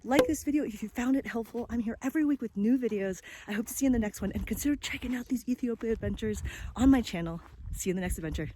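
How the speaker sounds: bright, lifted sign-off to viewers; cheerful YouTuber call to action; upbeat vlog outro. Acted.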